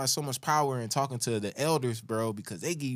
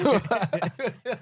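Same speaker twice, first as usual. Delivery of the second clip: high laugh; 9 semitones higher, 3 dB louder than usual. Retold or imitated. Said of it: "That's your old lady voice".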